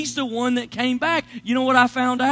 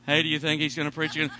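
low, quick voice instead of a whisper; failed whisper, changed tone; 8 semitones lower, faster than usual. Retold or imitated. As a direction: drop the pitch but keep it voiced. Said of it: "Don't change the tone of your voice".

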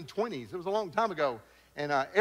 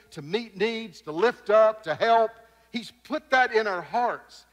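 rising sermon cadence, each verb louder; preaching; 3 semitones higher, 4 dB louder than usual. Acted. Pastor urging the congregation toward service, preaching. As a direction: build the list like a call to serve.

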